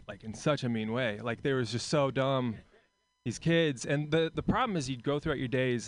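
ordinary delivery

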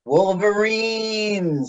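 slow, high, drawn-out call; overacted death scene. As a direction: moan the name like a dying hero. Echoing the movie's dying call.

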